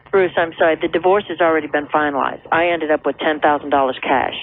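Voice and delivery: ordinary delivery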